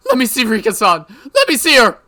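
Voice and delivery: high, loud crying shouts; desperate plea. Acted. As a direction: beg through tears. Mion begs to see Rika.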